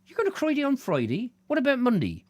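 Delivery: high comic questioning voice; high-pitched playful character voice; questioning local. Pretend. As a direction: pipe up as a curious villager. Acted dialogue, second local asking.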